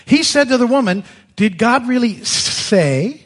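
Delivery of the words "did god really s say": a lightly hissed, crafty serpent asking; sly serpent voice with a raised pitch; snake voice. Acted. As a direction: tempt her slyly, like a snake. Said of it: "that's my snake voice".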